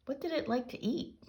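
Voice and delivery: high, shaky scared question. A narrator's reading. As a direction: quiver with nervous fear. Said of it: "Timmy asked in a shaky voice".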